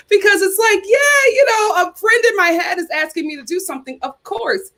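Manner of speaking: excited retelling. Retold or imitated. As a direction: relive the thrill of being asked. Retelling excited yes to friend.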